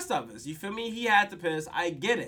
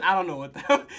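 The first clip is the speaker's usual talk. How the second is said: usual speaking voice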